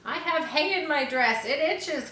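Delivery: put-on high, complaining girl; cried out. A narrator's reading. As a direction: whine it up high about the itch. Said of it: "cried Fern".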